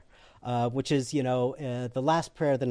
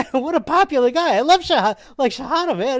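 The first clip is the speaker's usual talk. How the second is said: high, loud gushing; mock fan enthusiasm; 10 semitones higher, 8 dB louder than usual. Newcomer thinking Shahada is a popular guy, gushing. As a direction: rave as if about a rock star.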